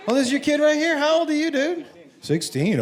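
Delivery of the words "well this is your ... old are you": ordinary talk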